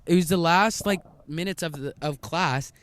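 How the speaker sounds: usual speaking voice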